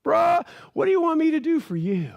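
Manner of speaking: hammed-up friendly shout; casual Jesus calling out. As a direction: hail the beggar like a buddy. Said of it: "He's like".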